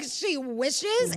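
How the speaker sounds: high, loud incredulous question; affronted, rising disbelief; indignant echo. Retold or imitated. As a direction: throw the words back in outrage. Indignant echo of his brush-off.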